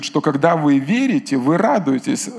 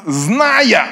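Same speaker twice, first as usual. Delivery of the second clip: preaching shout; 6 semitones higher, 4 dB louder than usual. Acted. Preaching peak word.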